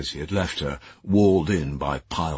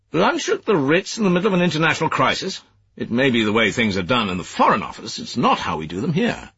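higher, louder reproachful officer; stern superior; 8 semitones higher, 4 dB louder than usual. Acted. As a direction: scold like a disapproving senior official. Cleverley rebukes Leggett.

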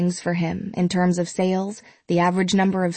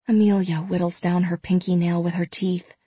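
plain reading voice

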